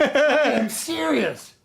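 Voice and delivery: loud, slow, stern correction; stern boss. Acted. Boss sternly correcting the laughing new manager, slow and loud. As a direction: shut the laughter down.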